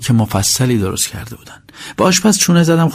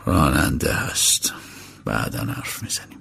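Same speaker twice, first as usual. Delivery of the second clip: much lower and softer, slow and unbothered; cool and calm; 12 semitones lower, 8 dB quieter than usual. Acted. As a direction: hush her coolly, slowly. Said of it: "با خونسردی گفت".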